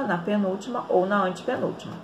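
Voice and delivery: ordinary talk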